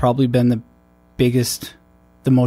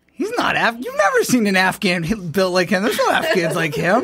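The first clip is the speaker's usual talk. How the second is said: higher-pitched imitation of incredulous protest; retold amused disbelief, pitched up; mock disbelief; 8 semitones higher than usual. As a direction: imitate someone scoffing at an absurd claim. Retold locals disputing he is Afghan.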